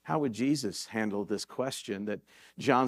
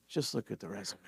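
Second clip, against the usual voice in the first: calm, much softer and lower, unhurried; calm and simple; 5 semitones lower, 12 dB quieter, faster than usual. Retold or imitated. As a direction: say it quietly with total assurance. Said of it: "He very calmly simply says".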